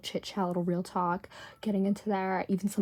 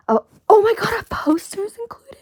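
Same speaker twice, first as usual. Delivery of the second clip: breathy, high and loud delighted gasp; thrilled to find a bonus poster; excited gasp; 11 semitones higher, 12 dB louder than usual. Real, not acted. Finds poster in box.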